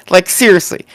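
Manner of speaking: ordinary talk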